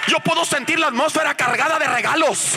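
high, shouted revival preaching; full-force preaching. Real, not acted. Preacher rouses congregation to praise God, full-force preaching. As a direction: drive each promise home, stretching the key words.

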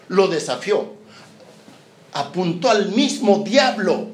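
ordinary delivery

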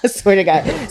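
said through loud laughter; laughing. Real, not acted. Joking to co-hosts about getting excited, laughing. Laughing over her own joke.

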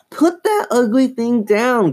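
high, impatient order. A narrator's reading. Chris orders her sister, high and impatient. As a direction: order it with pitch pushed up. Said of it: "Chris replied impatiently".

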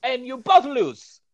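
high, loud, slow mock boss voice in broken English; mocking Vince. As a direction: ham up a clueless old boss talking down. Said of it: "And Vince is like".

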